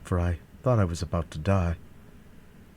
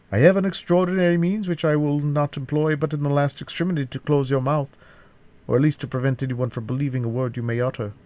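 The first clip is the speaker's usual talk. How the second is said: higher-pitched villain laying out a threat; threatening captor; 7 semitones higher, 3 dB louder than usual. A narrator's reading. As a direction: threaten the prisoner in a raised voice.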